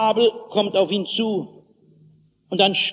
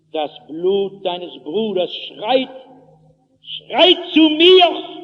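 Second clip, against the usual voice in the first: Stimme Gottes; slower than usual. Acted. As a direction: swell into a drawn-out divine accusation. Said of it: "Da redet die Stimme weiter". (langsam anschwellende, anklagende Gottesstimme)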